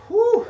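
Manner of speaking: big high whooshing blow of breath; loud exhale. Real, not acted. Coach to viewer mid-workout, loud winded whew. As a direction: blow it out hard after the effort. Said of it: "I'm a little bit out of breath".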